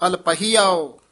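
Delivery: ordinary talk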